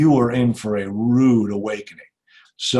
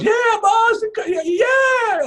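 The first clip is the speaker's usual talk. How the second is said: excited shout; 4 dB louder, much slower than usual. Retold or imitated. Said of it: "and somebody goes".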